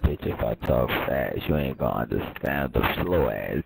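deep, dragged slow-mo voice; low and dragging like slowed tape; slow motion voice. Pretend. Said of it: "Sounded like ... in slow motion".

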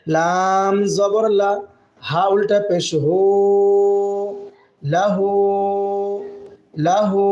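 drawn-out syllable reading; teacher spelling out. Shown as a demonstration. Teacher drilling students, slow spelling. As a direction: sound each syllable slowly, then the word. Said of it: "spelling out Arabic word".

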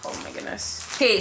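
normal talking voice

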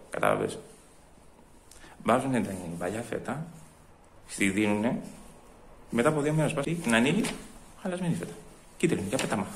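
very soft, low aside; muttered grumbling. Acted. Customer complaining aside about spoiled feta, muttering. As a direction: grumble under your breath about being cheated.

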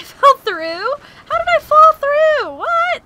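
high, loud disbelieving cry; startled outburst; surprised shout. Real, not acted. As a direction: yell in shock at the glitch. Falls through the map glitch.

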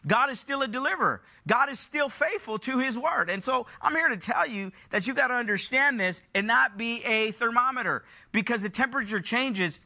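high-pitched, driving sermon delivery; urgent pulpit exhortation; impassioned preaching. Real, not acted. Preaches with rising force.

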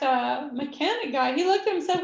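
ordinary talk, nothing marked

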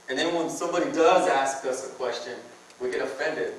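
normal talking voice

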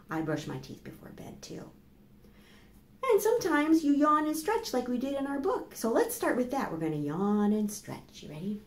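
usual speaking voice

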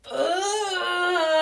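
loud, high imitated groan of disgust. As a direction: let out a big disgusted "ugh". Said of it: "he said".

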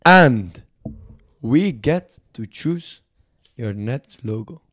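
hammy French negotiator; put-on French accent. French envoy dictating deal terms to podcast hosts, French accent. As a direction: lay down terms in a mock French accent, pausing between phrases. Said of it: "Fuck this French accent".

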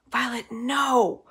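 slow urgent whisper; hissed whisper. A narrator's reading. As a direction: hiss it under your breath. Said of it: "I hiss".

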